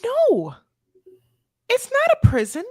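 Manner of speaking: very high, squeaky put-on voice, slow; mock squeaky voice. Mocking the prison line to cohost, squeaky high voice. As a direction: pipe it up like a cartoon.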